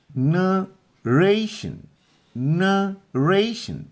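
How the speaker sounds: Narration very slow and high, split nuh / RAY-shun; high, stretched teacher's model of narration, weak first and last syllables; stress on middle syllable. Shown as a demonstration. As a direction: draw out narration, pressing on the middle रे. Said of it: "अधिक जोर लगाना है".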